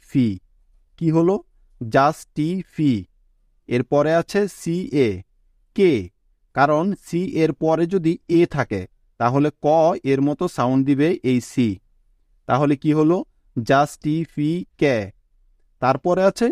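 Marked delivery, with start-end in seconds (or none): 1.8-2.9 জাস্টিফি stretched out slowly; teacher drawing out জাস্টিফি; slow, syllable by syllable. Shown as a demonstration. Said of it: "ভেঙে ভেঙে উচ্চারণ করার চেষ্টা করবে". 13.6-15.0 জাস্টিফি stretched out slowly; teacher drawing out জাস্টিফি; slow, syllable by syllable. Shown as a demonstration. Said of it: "ভেঙে ভেঙে উচ্চারণ করার চেষ্টা করবে".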